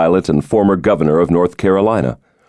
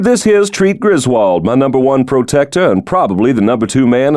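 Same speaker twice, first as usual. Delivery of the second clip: higher-pitched, jovial, confident politician; genial president; 4 semitones higher than usual. Acted. The president jokes to his old roommate, jovial and higher. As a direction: play the charming president joking about his security.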